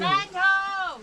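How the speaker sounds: high excited shout; shouting from crow's nest. Real, not acted. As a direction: call out land sighted. Spotting land, calling out.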